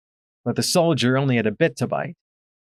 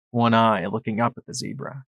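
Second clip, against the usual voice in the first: ordinary delivery, nothing marked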